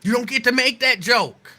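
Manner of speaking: high, loud and indignant; emphatic outburst. Real, not acted. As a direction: slam the point home. Repeats the line with more force.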